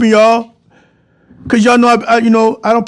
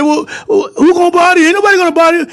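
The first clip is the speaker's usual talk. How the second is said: innocent owner; 8 semitones higher, faster than usual. Retold or imitated. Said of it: "turned around and said".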